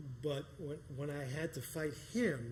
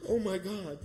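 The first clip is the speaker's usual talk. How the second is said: breathless "oh my God", a notch louder; shocked gasp; 5 semitones higher, 6 dB louder than usual. Retold or imitated. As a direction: caught off guard by the shove.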